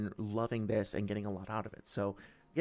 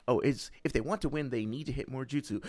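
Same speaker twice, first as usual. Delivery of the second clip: ordinary talk, nothing marked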